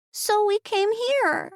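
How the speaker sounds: put-on high, slow child's voice; little girl. A narrator's reading. Ember explaining to her aunt, high slow child voice.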